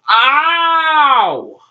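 high, drawn-out play howl; mock pain howl. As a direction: howl as if hit hard in the match. Wrestler hit in play match.

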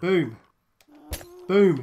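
triumphant boom. Real, not acted. Explosion sound effect.

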